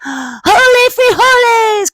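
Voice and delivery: hammed-up gasp of surprise; startled cry. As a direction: shout it in wide-eyed shock. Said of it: "cried Scipito".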